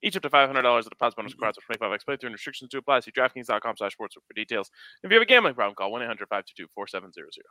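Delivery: rapid-fire fine print, flat and fast; fast legal disclaimer. Acted.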